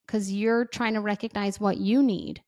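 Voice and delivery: usual speaking voice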